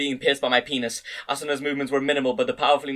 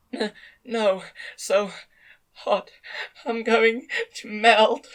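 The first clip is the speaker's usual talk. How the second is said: put-on high, thin young woman's voice, slow at the end; a dazed, trembling young woman; 8 semitones higher, much slower than usual. A narrator's reading. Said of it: "in a thin, high voice".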